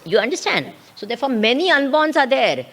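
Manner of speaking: ordinary delivery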